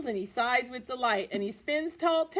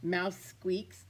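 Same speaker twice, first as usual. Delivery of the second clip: usual speaking voice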